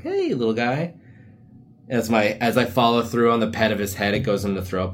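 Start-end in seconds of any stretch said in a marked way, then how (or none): 0.0-1.0 talking to dog. Pretend. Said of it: "I'm like".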